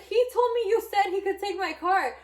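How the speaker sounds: high, loud, defensive outburst; retold indignant protest. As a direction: protest in alarm, pitch way up. Retold alarmed protest to friend.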